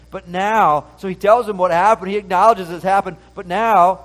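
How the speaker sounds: loud, impassioned sermon reading; fervent preaching. Acted. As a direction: proclaim it to the congregation with fire. Preaching with rising force.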